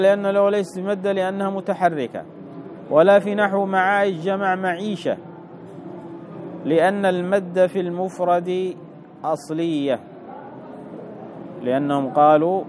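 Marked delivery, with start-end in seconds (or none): 0.0-10.0 higher, even-pitched reading voice; reading out text. Acted. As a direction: read the text aloud on a level raised note. Reads out the textbook. (قراءة متن بنبرة مرتفعة مستوية)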